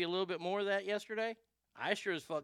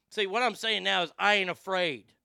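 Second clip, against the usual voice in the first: wrestling promo; 3 semitones higher, 5 dB louder, slower than usual. Real, not acted. Wrestling promo style bravado.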